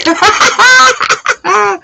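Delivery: high-pitched burst of laughter; real giggling fit at one's own joke. Real, not acted.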